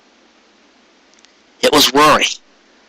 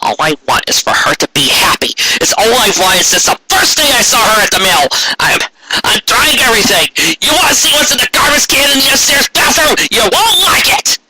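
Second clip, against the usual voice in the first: rant building to a shout; 9 semitones higher than usual. Acted. Carrot's rant keeps building.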